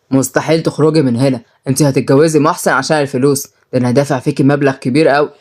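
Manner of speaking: low, menacing male figure; domineering brother. Acted. Brother forcing his sister to marry, domineering.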